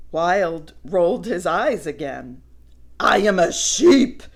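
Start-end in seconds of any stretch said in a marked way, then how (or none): 3.0-4.2 loud, huffy insistence; snorting, annoyed. Acted. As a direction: huff it indignantly. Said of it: "he snorted".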